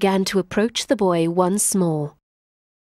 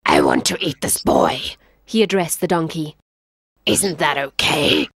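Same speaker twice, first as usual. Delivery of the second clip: low lion voice; 5 semitones lower than usual. Acted. Lion asks donkey.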